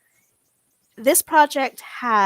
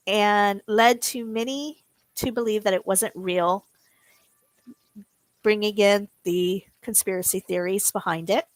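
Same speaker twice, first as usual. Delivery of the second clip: ordinary delivery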